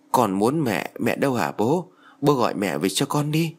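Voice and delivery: ordinary delivery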